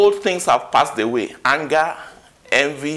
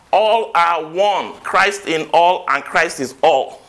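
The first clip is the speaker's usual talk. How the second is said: slow proclaiming; 2 semitones higher, slower than usual. Acted. Preacher proclaims the verse's climax.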